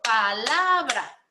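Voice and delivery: slow, syllable by syllable: pa-la-bra; split into syllables. Shown as a demonstration. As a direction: clap out palabra as pa-la-bra, slowly. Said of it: "Teníamos que ser, por ejemplo".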